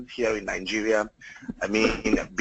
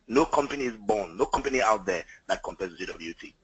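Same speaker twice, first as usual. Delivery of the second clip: ordinary talk, nothing marked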